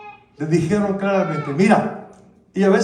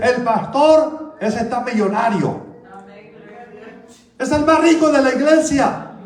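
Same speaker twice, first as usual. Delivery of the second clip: mocking gossip; 5 semitones higher than usual. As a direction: sneer at the rich pastor. Mocking gossipers about pastor.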